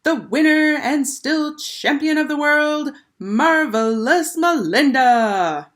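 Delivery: high, loud, slow ring announcer; girl bellowing like a boxing announcer to crown the winner. A narrator's reading. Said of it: "she bellowed".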